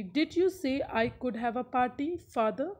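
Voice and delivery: ordinary delivery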